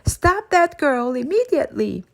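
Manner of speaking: put-on dripping cook shouting at the inmates to catch the girl. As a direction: bark the order across the hall. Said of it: "was shouting".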